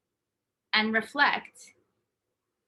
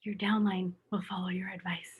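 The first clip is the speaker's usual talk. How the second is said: breathy whisper of the punchline; 2 semitones lower, 6 dB quieter than usual. Real, not acted. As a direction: let it out as a confiding whisper. Confiding punchline to viewers.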